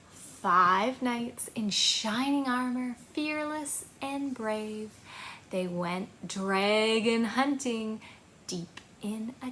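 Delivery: put-on slow rhythmic rhyme for kids, rising at the end; finger-play rhyme. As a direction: recite it like a storytime chant.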